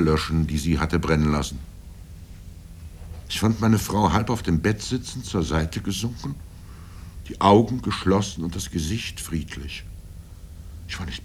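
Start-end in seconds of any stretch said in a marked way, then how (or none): none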